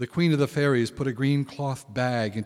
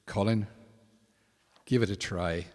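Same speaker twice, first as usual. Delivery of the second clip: gentle coaxing; 4 semitones lower, 5 dB quieter than usual. Acted. The Púca coaxes gently.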